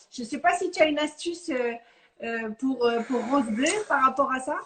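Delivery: ordinary delivery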